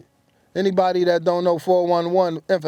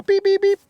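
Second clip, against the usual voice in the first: high, louder beep-beep sound; imitated beeper; 5 dB louder than usual. Telling the crowd his beeper went off, high electronic beeps. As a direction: chirp it like an old pager. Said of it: "Beeper, y'all".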